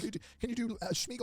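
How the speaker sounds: high retold kid request; lightly voiced kid asking a favour; pestering kid. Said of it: "And he's like".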